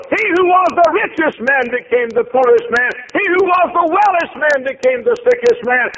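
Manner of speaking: high, loud preacher's cry; preaching at full force. Acted. Preacher crying out Job's losses to the congregation, full force. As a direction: shout the loss out over the pews.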